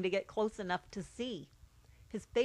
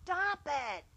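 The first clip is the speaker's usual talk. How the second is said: mock scream; 8 semitones higher than usual. Older brother to the baby touching him from the next seat, mock scream. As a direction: mildly yell it like an annoyed big brother. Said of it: "His brother would scream".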